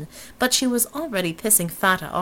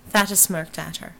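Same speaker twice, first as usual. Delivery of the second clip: usual speaking voice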